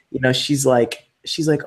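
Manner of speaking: ordinary talk, nothing marked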